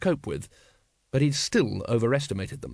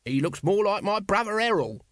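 high, cheeky character voice; chirpy city sparrow; 8 semitones higher than usual. A narrator's reading. Nobby chatting up a lady, chirpy and familiar. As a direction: play the lovable chirpy urchin. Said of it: "chirpy, lovable city sparrow".

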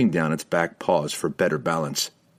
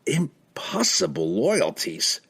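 slow, higher, baffled words; dumbfounded; 9 semitones higher, slower than usual. A narrator's reading. Wizard Laval replying to Pook, dumbfounded. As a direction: draw it out in amazement. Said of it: "truly dumbfounded".

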